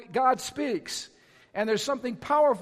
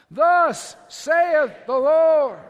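thunderous wrathful prophet, slow and higher; Old Testament prophet; 4 semitones higher, 9 dB louder, much slower than usual. Shown as a demonstration. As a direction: boom it out like a prophet. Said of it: "say it like a prophet now, an Old Testament prophet".

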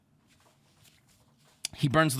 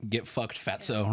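low, soft, unsympathetic put-down; no sympathy; 10 semitones lower, 5 dB quieter than usual. Retold or imitated. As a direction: imitate an uncaring pit boss. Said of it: "no sympathy".

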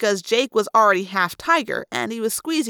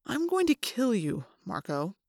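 soft, eerily calm threat; hushed calm, dropping away on the name; weirdly calm; 8 dB quieter than usual. A narrator's reading. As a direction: say it very quietly, with controlled menace. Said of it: "in a weirdly calm voice".

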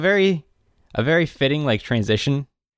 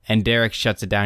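usual speaking voice

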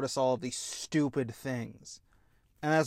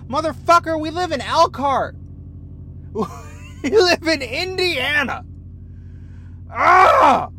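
much louder and higher, a shout of disbelief; exasperated yell; 10 semitones higher, 10 dB louder than usual. Real, not acted. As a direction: yell across the street at the neighbor. Yelling about neighbor's car.

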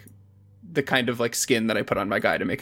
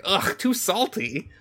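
whiny disgust; 8 semitones higher, slower than usual. Retold or imitated. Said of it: "he's like".

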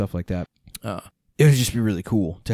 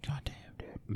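very soft, breath-only whisper; barely whispered exclamation; 4 semitones higher, 18 dB quieter than usual. Real, not acted. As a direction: whisper it, almost inaudibly. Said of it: "Why are you whispering?".

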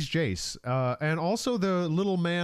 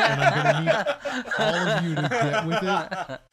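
loud, higher playful decree; mock proclamation; 6 semitones higher, 4 dB louder than usual. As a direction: announce it like a proud decree. Mock decree on show name.

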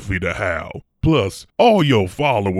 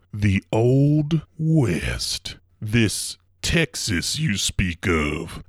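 slow mystic voice; 3 dB quieter, much slower than usual. Acted. Dreamy musing on a past life.

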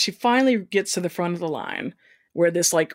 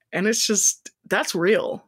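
ordinary delivery

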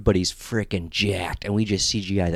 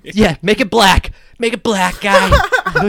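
excited; 9 semitones higher, 6 dB louder, much slower than usual. Real, not acted. Excited suggestion.

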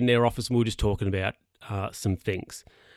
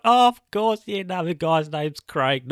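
mock realisation; 5 semitones higher than usual. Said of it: "So I'm like".

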